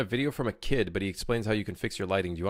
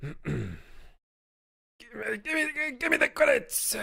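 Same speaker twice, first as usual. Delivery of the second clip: much higher, squeaky, demanding; Watto impression; 12 semitones higher than usual. Streamer does Watto demanding credits, high and squeaky. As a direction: squeal for the money like a greedy trader. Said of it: "is that what he sounds like?".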